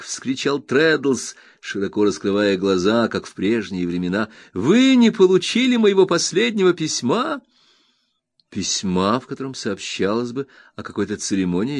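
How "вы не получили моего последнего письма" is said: high, wide-eyed disbelieving question; can't believe the letter never came; astonished Traddles. A narrator's reading. Traddles asking Copperfield about his letter, high and amazed.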